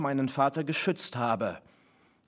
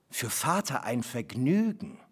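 plain reading voice

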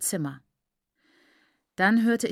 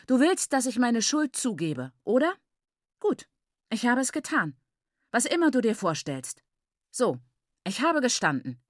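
raised, defiant mock confession; unrepentant; 5 semitones higher than usual. A narrator's reading. Daughter gives her father the confession he wants, defiantly. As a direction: concede it with no remorse at all. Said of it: "immer noch ohne Reue".

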